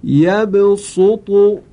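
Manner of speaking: slow chanted tajwid recitation of یبسط; یبسط in drawn-out Quran recitation; Quranic recitation. Shown as a demonstration. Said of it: "این کلمه هست".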